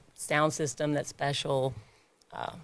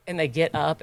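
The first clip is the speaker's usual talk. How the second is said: ordinary talk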